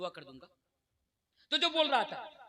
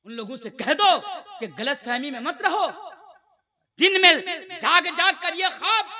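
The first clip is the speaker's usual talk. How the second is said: fervent sermon voice, rising in bursts; preaching at full force; 6 dB louder than usual. Acted. Preacher rousing the congregation about faith, fervent preaching. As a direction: preach it to the crowd with conviction.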